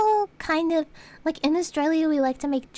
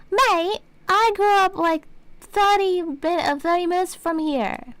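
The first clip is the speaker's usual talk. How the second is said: louder, higher, drawn-out mock indignation; exasperated retort; 4 semitones higher, 3 dB louder than usual. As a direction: protest it slowly and emphatically, acting it out. Retold exasperated retort to doctor.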